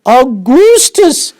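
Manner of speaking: high, long, ringing shout of a name; a frantic mother yelling her son's name. Acted. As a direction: call him back at the top of your voice. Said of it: "shouted Missus Gloop".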